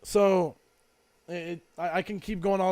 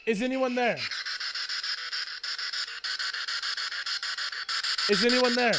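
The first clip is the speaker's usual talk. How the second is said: calling out to spirits; 4 semitones higher than usual. Acted. Asking the spirit box questions.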